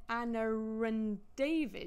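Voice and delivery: emphasised unwritten R after "Anna"; intrusive R. Shown as a demonstration. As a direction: stretch the added R in "Anna-r-and David". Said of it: "You hear the R between".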